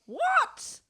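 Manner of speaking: squealed high disbelief; a very high shocked What. Real, not acted. Shocked at own fact.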